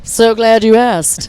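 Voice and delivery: loud, slow, drawn-out star impression; Mae West. Singer answering how else she can sing it, Mae West impression. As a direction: stretch the words out boldly, playing the diva. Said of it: "then I got to be Mae West".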